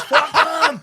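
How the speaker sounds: high, very loud outburst; mock defiant shout. Bar owner about only four supporters, defiant mock yell. Troy's defiant reply acted out.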